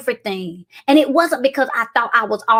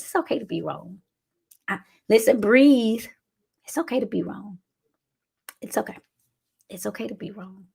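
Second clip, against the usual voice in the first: gentle, much softer comfort; soft reassurance; 3 semitones lower, 5 dB quieter than usual. Real, not acted. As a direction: ease them softly into it.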